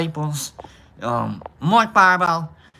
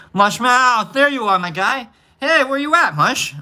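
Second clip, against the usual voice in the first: excited greeting; 8 semitones higher, 4 dB louder than usual. Real, not acted. Calling out to a friend in chat.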